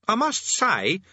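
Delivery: put-on high, whiny boy's voice; Eric complaining. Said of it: "complained Eric".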